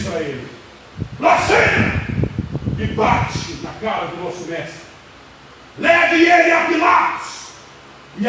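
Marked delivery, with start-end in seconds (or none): 1.2-2.0 high-pitched cry of outrage; outraged accusation. Acted. High priest accuses Jesus before striking him, outraged cry. 5.8-7.3 high, shouted command; shouted order. Acted. High priest sends Jesus to Pilate, shouted order.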